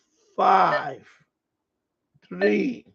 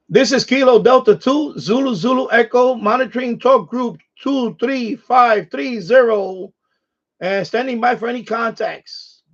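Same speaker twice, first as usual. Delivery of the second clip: radio operator call; 6 semitones higher, 8 dB louder than usual. Acted.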